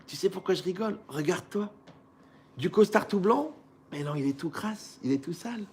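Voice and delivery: soft, mocking reply from the floor; quiet mockery. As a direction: needle him quietly, half laughing. Beaten man mocks the winner.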